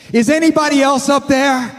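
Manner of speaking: a frantic comic plea to anyone else; panicked hiker. Retold or imitated. Hiker rejects God's answer, calling out high and panicked. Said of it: "and he says".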